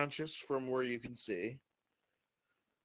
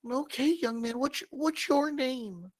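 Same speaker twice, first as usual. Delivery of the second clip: put-on a sweet old woman asking kindly, pitched up; old lady; 12 semitones higher than usual. Old lady talks to George.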